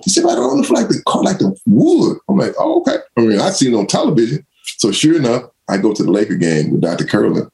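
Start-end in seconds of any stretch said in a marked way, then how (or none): 0.2-2.2 much higher, matter-of-fact explaining voice; team doctor. Retold or imitated. Doctor explaining floor seats. 2.6-3.0 much higher, impressed "oh, okay"; surprised rookie. Retold or imitated.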